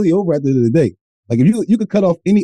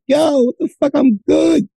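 mock outraged shout; 12 semitones higher than usual. Man refusing the shingles diagnosis, high mock shout. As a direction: shout it like denial can cure you. Retold own freakout, shout heard.

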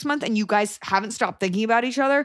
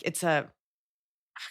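ordinary talk

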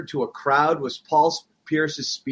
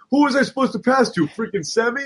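pretend Hall of Fame speech; 7 semitones higher than usual. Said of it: "they're like".

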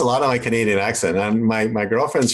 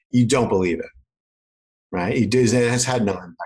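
ordinary delivery

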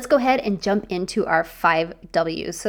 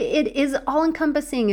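ordinary talk, nothing marked

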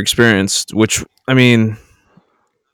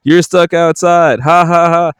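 mocking laugh; 8 semitones higher than usual. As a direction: jeer at him through the glass. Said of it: "we're literally just like".

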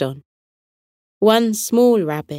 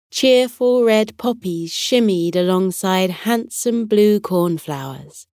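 usual speaking voice

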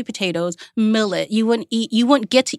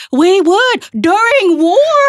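put-on slow, much higher and louder old man; grandpa's blunt retort; 13 semitones higher, 8 dB louder, much slower than usual. Grandpa snaps back at grandchild, slow and much higher. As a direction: drag it out like a scandalized grandpa.

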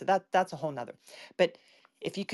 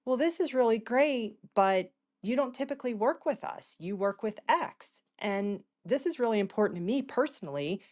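eager pitch; 7 semitones higher than usual. Retold or imitated. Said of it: "I looked at him and I said".

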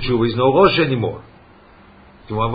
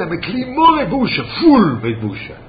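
impassioned cry; 9 semitones higher, 4 dB louder than usual. Acted. Preacher's climactic outcry.

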